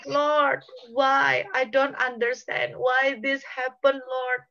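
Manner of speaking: high, drawn-out wail of protest; acted anguished cry. Preacher as David questioning God's promise, crying out in anguish. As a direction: cry out to God, pleading for answers. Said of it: "Mungkin dia teriak sama Tuhan".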